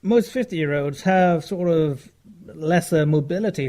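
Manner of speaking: ordinary talk, nothing marked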